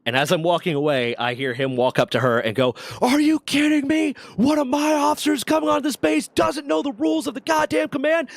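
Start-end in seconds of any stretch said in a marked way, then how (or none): 3.0-8.3 angry dressing-down. Retold or imitated. Major chews out officer.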